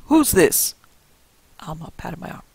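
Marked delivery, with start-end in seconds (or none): 0.1-0.7 loud, higher demand; old man roaring about the stranger; roared. A narrator's reading. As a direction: bark it out suspiciously. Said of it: "he roared".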